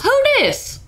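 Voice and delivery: high sassy squeak. Pretend.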